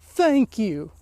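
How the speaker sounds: a hint of the heel's put-on voice on "Thank you."; lightly mock her prim, self-satisfied thanks; lightly voiced heel, pleased with herself; Vicky's smug thank you. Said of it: "she still says".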